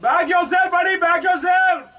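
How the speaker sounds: loud high shout of encouragement; six call. Real, not acted. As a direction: yell at the batsman to back himself. Shouting at batsman on screen.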